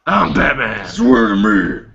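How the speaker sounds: low, slow-burning tough-guy growl; Batman. Acted. Joking to friends about a picture, deep Batman voice. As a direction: put on a deep superhero voice. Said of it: "doing a deep voice".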